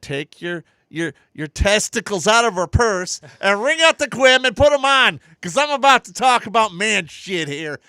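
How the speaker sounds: loud, high hammed-up crowd address; stage comic shout. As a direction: bellow it at the audience for laughs. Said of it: "I'm like".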